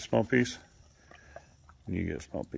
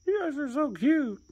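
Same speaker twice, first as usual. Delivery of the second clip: cooing. Real, not acted. Owner cooing at the cat and dog in a high voice. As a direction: gush over them way up high.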